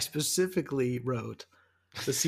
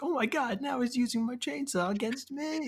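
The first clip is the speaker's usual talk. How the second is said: higher, lightly acted disbelief; lightly voiced indignant neighbor, higher; mock outrage; 11 semitones higher than usual. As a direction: play the victim who can't believe it. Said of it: "It's like".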